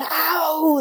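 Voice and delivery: sharp pained yelp, high and strained; a short pained "au" cut off in a half-cry; retold cry of pain. Painful exam, crying out. (kort smertefullt hyl)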